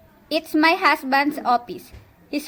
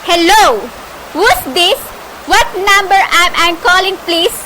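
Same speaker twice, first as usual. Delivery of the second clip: higher, louder, alarmed questions into the phone; she's startled by strange voices on the line; nervous invalid demanding who's on the phone; anxious; 5 semitones higher, 8 dB louder than usual. Acted. Frightened woman on crossed line.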